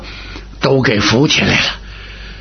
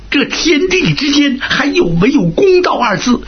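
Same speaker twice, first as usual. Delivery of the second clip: high, crying cry for justice; hammed-up weeping protest; mock tearful wail; 13 semitones higher than usual. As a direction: put on a wailing sob of grievance. Huang Ming fakes tearful outrage.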